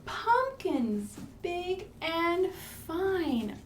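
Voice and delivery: storybook build to a slow, whispered surprise; hush, then reveal; reading to children. Acted.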